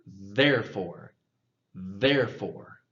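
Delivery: therefore drawn out slowly; slow drill. Shown as a demonstration. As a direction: stretch therefore out. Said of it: "let's get that T H practice".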